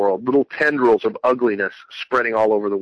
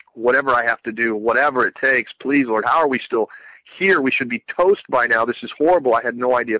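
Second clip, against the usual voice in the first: usual speaking voice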